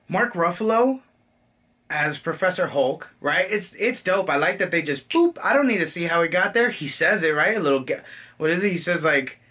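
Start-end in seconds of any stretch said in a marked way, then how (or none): none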